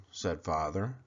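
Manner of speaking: ordinary delivery, nothing marked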